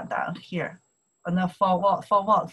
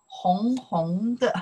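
slow model word; slower than usual. Shown as a demonstration. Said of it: "We like to pronounce double time".

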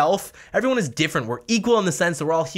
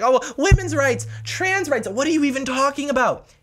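mocking whine; 10 semitones higher than usual. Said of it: "People are like".

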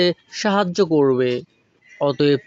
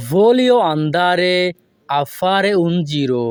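very slow, careful Italian; slow model phrase; much slower than usual. Shown as a demonstration. Teacher modelling the Italian slowly for students. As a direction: draw the sentence out for learners. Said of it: "এই বাক্যটি যদি আমরা ভেঙে ভেঙে বলি".

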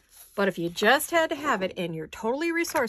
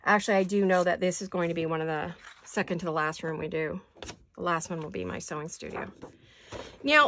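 ordinary delivery

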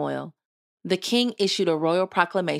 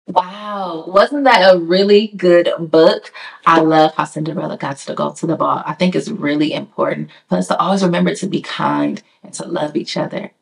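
much louder cheerful sign-off; loud outro; 11 dB louder than usual. Real, not acted. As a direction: wrap up the show loudly to viewers. Loud storytime outro to viewers.

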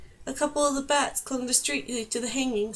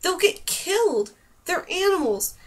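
angry outburst; 7 semitones higher, 3 dB louder than usual. A narrator's reading. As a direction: shout it at her in anger. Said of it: "Dane glared at her".

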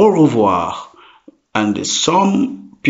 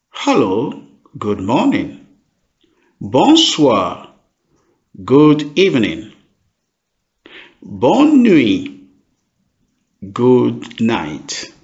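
usual speaking voice

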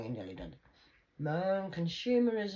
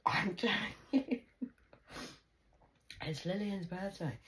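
ordinary delivery

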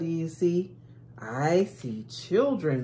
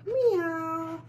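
cat meow voice. Acted.

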